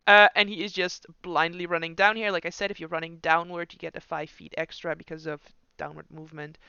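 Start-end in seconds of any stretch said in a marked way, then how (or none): none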